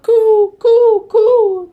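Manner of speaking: loud, high hooty coos; dove cooing. A narrator's reading. Dove in the noisy recap, loud high cooing. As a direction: coo high and loud with long vowels. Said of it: "The dove".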